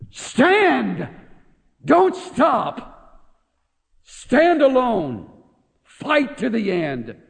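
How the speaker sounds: rally a nation to stand firm; thundering wartime speech; Churchill-style oratory. Retold or imitated. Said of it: "I wish I had that great British accent to read his words".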